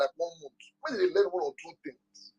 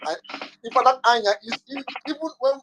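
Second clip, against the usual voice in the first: ordinary talk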